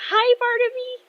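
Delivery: very high, loud fangirl squeal; excited greeting. Acted. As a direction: squeal hello like a thrilled girl. Thrilled girl greeting her idol.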